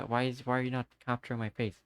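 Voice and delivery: usual speaking voice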